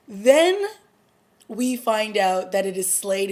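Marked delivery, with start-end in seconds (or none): 0.1-0.6 excited. Real, not acted. Dramatic build in recap.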